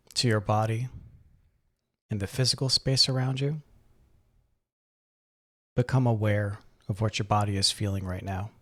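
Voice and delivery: calm, unhurried meditation guide, gradually softening; guided meditation. Acted. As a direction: ease the listener into relaxation, leaving long pauses. Leads a breathing meditation.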